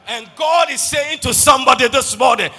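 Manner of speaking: loud, high shouted promise to the congregation; roaring pulpit declaration; full-force preaching. Acted. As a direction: proclaim it at the top of your voice.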